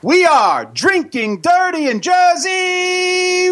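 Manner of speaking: slow, high shouted show title. Acted. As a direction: belt out the show name.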